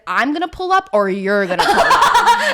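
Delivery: half-joking ultimatum with a laugh; laughing threat. Retold or imitated.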